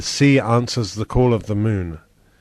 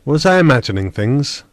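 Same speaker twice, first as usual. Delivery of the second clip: usual speaking voice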